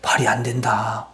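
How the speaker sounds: softly whispered, as if shaking his head; hushed dismissal. Retold or imitated. King Saul to young David, a whispered dismissal. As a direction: breathe it out, doubting the boy.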